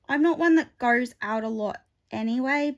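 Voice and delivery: slipping into a light Australian accent. Real, not acted. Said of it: "going into an Australian accent".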